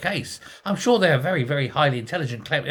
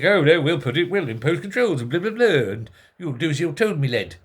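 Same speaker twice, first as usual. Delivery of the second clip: a hint of an old-fashioned boss lecturing a lad; mock bossy Labour official; 2 semitones higher than usual. A hint of a Labour official ordering a worker about, pompous. Mocking Labour's 1950s attitude.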